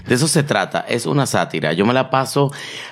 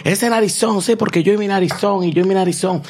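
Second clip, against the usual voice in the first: higher, playful self-mockery; singsong joke about his own nose; mock self-teasing; 6 semitones higher than usual. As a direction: poke fun at your own big nose. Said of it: "Yo siempre digo".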